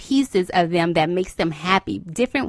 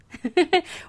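high laugh; 10 semitones higher than usual. Real, not acted. Laughing at her own line.

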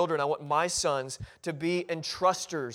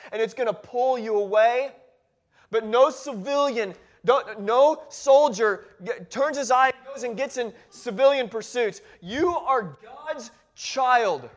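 raised pitch, emphatic pulpit warning; impassioned preaching; 5 semitones higher, 5 dB louder than usual. Acted. Preacher warning Timothy against civilian pursuits, impassioned and emphatic.

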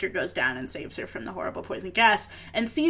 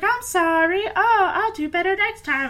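high, pleading mock-kid voice; apologetic child; 9 semitones higher, 4 dB louder than usual. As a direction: imitate a scolded little one apologizing. Voicing scolded children apologizing.

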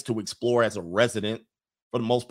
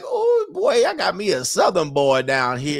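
delighted Southern lady; 7 semitones higher, 5 dB louder than usual. Retold or imitated. Host imitates ladies loving his charm, high Southern squeal. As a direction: squeal it like a smitten Southern belle. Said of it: "And the ladies love it. They like".

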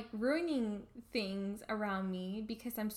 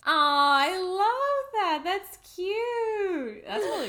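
much higher, louder, drawn-out aww; touched aww; 9 semitones higher, 10 dB louder, much slower than usual. Real, not acted. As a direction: melt at the sweet gesture. Touched by coffee named after her.

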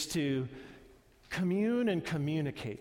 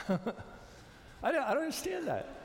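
laughing; 8 semitones higher, 4 dB quieter, slower than usual. Real, not acted. Preacher laughing at his own confusion to the congregation, laughing. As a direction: laugh through the words, breathy and high.

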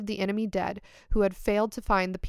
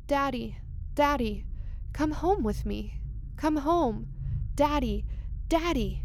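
put-on girl's voice; 5 semitones higher, slower than usual. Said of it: "Girl's voice".

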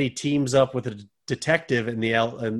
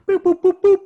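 high beeping dial tones; phone beeps; 8 dB louder than usual. Retold or imitated. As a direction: imitate dialing a phone. Imitating phone dialing tones.

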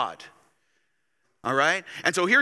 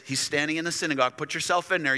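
ordinary delivery

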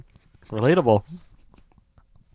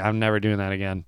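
defeated mutter; 7 semitones lower, 9 dB quieter, faster than usual. Retold or imitated. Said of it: "I was like".

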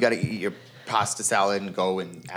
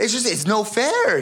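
whiny no fair; 10 semitones higher, slower than usual. Real, not acted. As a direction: still mad about his brother always getting shotgun. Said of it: "He's still mad".

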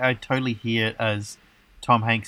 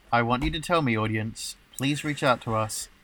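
usual speaking voice

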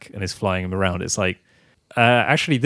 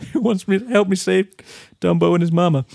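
mock kid's movie voice; 10 semitones higher than usual. Voicing a film trailer line.

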